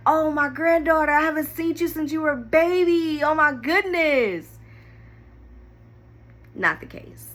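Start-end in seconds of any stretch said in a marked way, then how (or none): 0.1-4.6 high, gushing grandma voice; imagined sweet grandma greeting. Retold or imitated. Granddaughter imagining grandma's welcome, high and gushing. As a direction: coo it like a doting grandmother. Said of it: "be like".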